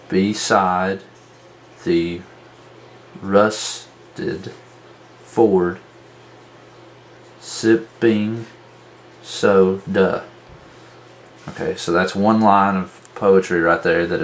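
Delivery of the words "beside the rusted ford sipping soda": "Beside the rusted ford, sipping soda" in separate syllables with long pauses. Shown as a demonstration. Teacher marking stressed and unstressed, "Beside the rusted ford, sipping soda" syllable by syllable. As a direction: sound out BEE-SIDE, THEE, RUS-TED, FORD, SIP-PING, SO-DA. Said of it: "begin to mark the stressed and unstressed".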